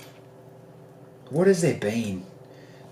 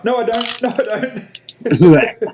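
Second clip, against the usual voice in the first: 1 drawn-out mock denial; 8 semitones higher, 5 dB louder, much slower than usual. Real, not acted.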